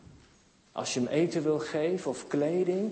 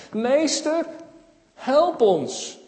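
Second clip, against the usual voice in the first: high, raised plea; acted calling out; 7 semitones higher, 5 dB louder, much slower than usual. Sick men calling to Jesus for help, high raised call. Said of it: "roepen ze".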